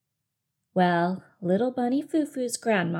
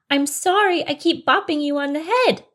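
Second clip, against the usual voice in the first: higher, louder repeated apology; insistent apology; 7 semitones higher, 6 dB louder than usual. Acted. Bunny repeating ignored apology to mouse, insistent louder voice. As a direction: push the apology at them.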